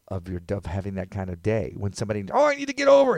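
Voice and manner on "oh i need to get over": high, loud mock demand; frantic driver. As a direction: blurt it out as an urgent, hammed-up plea. Frantic driver wanting into the lane.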